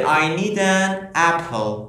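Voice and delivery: reads the English sentence slowly and clearly; slow example read. Shown as a demonstration. Teacher reading the example slowly to students. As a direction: say it slowly so learners catch an. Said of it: "یا گفته که".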